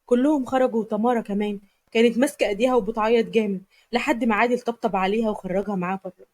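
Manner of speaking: ordinary delivery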